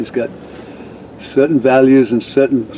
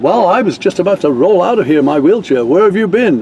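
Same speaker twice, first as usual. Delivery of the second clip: higher, mock-cranky old woman in a wheelchair; impatient old lady; 6 semitones higher than usual. Old lady scolding the late musicians, higher and cranky. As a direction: tease them for being late, old-lady style. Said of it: "and this old lady said".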